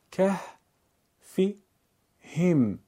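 که، فی، هم as three separate syllables; syllable-by-syllable reading. Shown as a demonstration. Teacher breaks up a word for learners: که، فی، هم spaced out. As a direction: pause after each part: که، فی، هم. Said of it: "بخش خوانی کنید".

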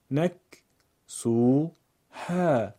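نک، سو، ها as three separate syllables; syllable-by-syllable reading. Shown as a demonstration. Teacher breaks up a word for learners: نک، سو، ها spaced out. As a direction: pause after each part: نک، سو، ها. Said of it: "بخش خوانی کنید".

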